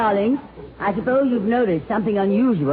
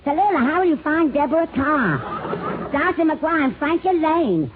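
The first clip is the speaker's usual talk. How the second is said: much higher mock voice of an announcer asking; mock questioner; 9 semitones higher than usual. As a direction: pitch it way up like a fussy questioner. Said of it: "a little funny way of my own".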